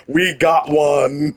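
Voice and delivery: slow, drawn-out movie catchphrase; Ghostbusters style. Retold or imitated. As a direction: hold it out like the Ghostbusters line. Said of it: "Ghostbusters style".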